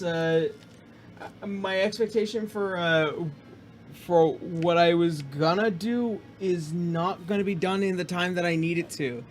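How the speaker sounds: usual speaking voice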